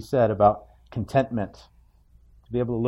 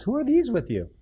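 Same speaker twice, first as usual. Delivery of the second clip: much higher, changed voice for the questioning brother; Esau asking; 12 semitones higher than usual. Retold or imitated. Esau asks Jacob about the women and children, higher voice. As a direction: ask it as a curious Esau. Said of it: "He says".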